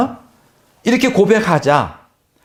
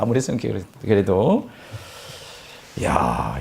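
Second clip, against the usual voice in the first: usual speaking voice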